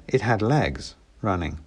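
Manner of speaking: ordinary delivery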